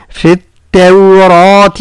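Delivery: التوراة slow, thin ta and full heavy ra. Shown as a demonstration. Teacher showing ta and ra in التوراة. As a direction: keep the ta light, the ra thick. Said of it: "هكذا".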